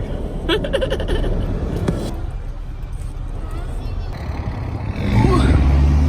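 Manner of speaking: airy unvoiced chuckle; breathy laugh. Real, not acted. Laughing at daughter's face.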